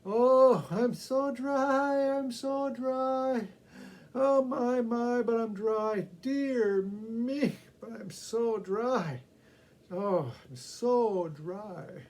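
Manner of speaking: high, slow, moaning complaint; a parched woman's drawn-out moan; thirsty woman's lament. Acted. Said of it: "her perpetual song of".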